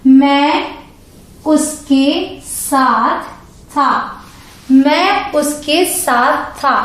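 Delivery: very slow model sentence; slow clear example; slow teaching read. Shown as a demonstration. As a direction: read it out slowly for the class. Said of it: "examples हैं".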